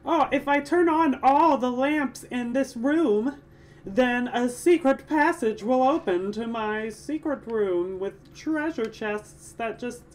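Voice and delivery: high, loud, drawn-out hammy voice; mock game character. Player mocking treasure-room logic in a high, hammed-up character voice. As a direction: play it broad, high and slow.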